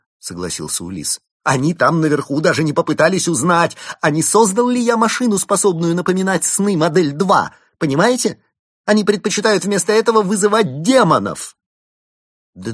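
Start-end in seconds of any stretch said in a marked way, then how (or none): 1.4-11.6 furious rant. Acted. Inventor rages at his superiors.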